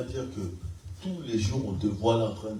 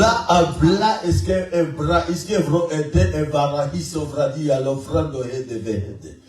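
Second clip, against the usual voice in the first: higher, louder, slow tongues prayer; praying in tongues; 5 semitones higher, 5 dB louder, slower than usual. Shown as a demonstration. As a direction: lift the tongues prayer up high. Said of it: "Je récupère trois".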